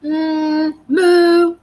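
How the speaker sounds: a high hammed-up moo; cow moo. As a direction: moo like a toddler's playful cow sound. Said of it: "And then he goes".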